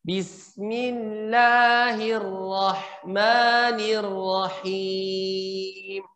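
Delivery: slow, measured Bismillahirrahmanirrahim with a clean trill; correct recitation. Shown as a demonstration. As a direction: recite it properly with tartil. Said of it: "yang tadi saya contohkan".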